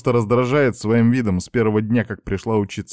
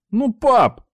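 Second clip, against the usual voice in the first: обиженное нытьё; 5 dB louder than usual. A narrator's reading. Сын протестует против наказания.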